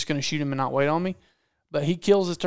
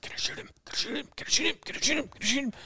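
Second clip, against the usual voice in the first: high, hushed, repeated pleading; frantic mental pleading; 9 semitones higher, 6 dB quieter, faster than usual. Retold or imitated. Hunter begging in his head to shoot the turkey, hushed and frantic. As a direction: squeak it softly, itching to fire. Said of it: "In his mind, he's like".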